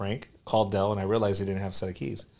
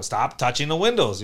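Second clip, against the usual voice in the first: a mild mock yell, louder; retold yell at Dell; 4 semitones higher, 6 dB louder than usual. Boss retelling how he nagged his worker, mild mock yell. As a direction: scold him half-heartedly. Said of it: "I used to yell at him".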